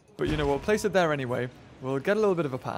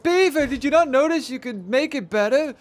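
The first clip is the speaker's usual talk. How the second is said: mock viewer complaint; 8 semitones higher, 4 dB louder than usual. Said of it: "people have been yelling at me".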